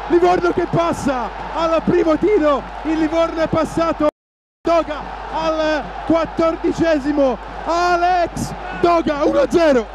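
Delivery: rising to a full goal scream; breathless italian goal commentary. Real, not acted. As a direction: yell the goal, breathless and high. Goal call, commentator erupts.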